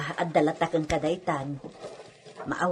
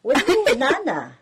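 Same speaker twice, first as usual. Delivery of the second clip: laughing; 7 semitones higher, 7 dB louder than usual. Acted. Laughing off the servant's question.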